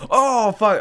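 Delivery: high, loud shocked outburst; shocked curse. Real, not acted. Player told to hit the handle, shocked curse. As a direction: blurt it in horror.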